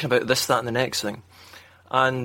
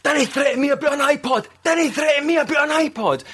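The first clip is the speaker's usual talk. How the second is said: mock Scottish accent; 11 semitones higher than usual. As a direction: bark it out in a hammed-up Scottish accent. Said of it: "I thought I was putting on the accent".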